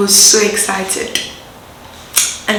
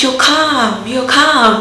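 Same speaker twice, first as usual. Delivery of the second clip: excited calm; 4 semitones higher, much slower than usual. Retold or imitated. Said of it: "you know when you're so excited that".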